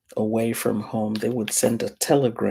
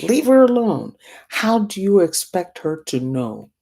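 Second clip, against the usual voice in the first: higher, louder retold rebuke; scolding; 6 semitones higher, 5 dB louder than usual. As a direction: snap at her to back off. Man scolding the mother, retold.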